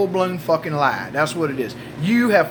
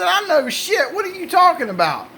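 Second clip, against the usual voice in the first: high, exasperated mock innocence; feigned bewilderment; 11 semitones higher than usual. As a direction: act clueless and put out. Husband feigns ignorance, acted quarrel.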